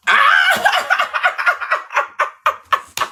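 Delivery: very high, shrieking burst of laughter. Real, not acted. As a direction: cackle wildly at the comeback. Explosive laugh after the comeback.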